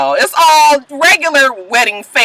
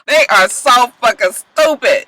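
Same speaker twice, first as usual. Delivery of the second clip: normal talking voice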